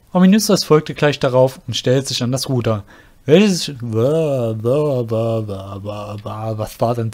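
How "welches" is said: ordinary talk, nothing marked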